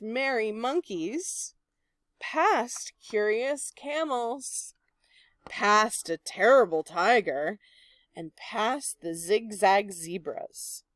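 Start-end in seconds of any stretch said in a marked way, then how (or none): none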